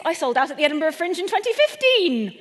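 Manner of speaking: escalating boast. Acted. Boasting sold-out years, escalating.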